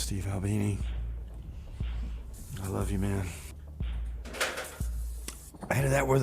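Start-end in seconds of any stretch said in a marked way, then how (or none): none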